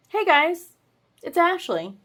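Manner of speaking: high, bright hey guys; excited greeting. Real, not acted. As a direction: burst in with a cheery hello. Belated cheerful greeting.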